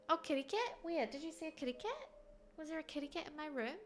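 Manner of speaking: put-on high sing-song baby talk; reading to a toddler. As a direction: coo as if to a small child. Coos about the cat.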